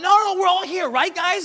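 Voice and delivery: high, loud comic shout; calling out. Acted. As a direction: play an eager Paul calling to the others. Acted Paul calling out for everyone.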